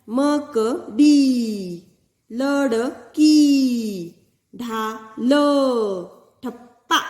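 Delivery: मकड़ी and लड़की drawn out slowly; slow teacher demo; very slow reading. Shown as a demonstration. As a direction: read each word very slowly. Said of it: "reading words for pupils".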